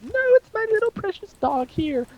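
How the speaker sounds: doting, very high falsetto; creepy put-on lady fussing over her pet; creepy woman voice. Woman defending her precious dog, creepy high woman voice. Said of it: "the creepiest woman voice".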